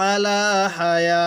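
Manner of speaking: slow recited আলা হায়া; pausal form. Shown as a demonstration. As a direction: stop on হায়া with the final letter read as হা. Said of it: "তখন কিভাবে পড়ব?".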